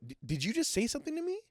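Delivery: high, quick, stammered retold question; incredulous question. Man asks bartender again if he spoke, high quick incredulous voice. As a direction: rush it out in high-pitched disbelief.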